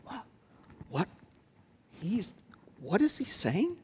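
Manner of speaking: hushed, high-pitched murmur of bewilderment; softly, as if puzzled in the pews; mock whispering. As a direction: whisper it in astonishment, voice lifted. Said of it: "people are whispering".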